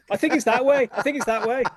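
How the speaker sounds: high, put-on know-it-all voice; mock him giving wrong directions; fussy imitation of Lawrence. Author mimicking Lawrence as backseat driver, high fussy voice. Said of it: "he starts going".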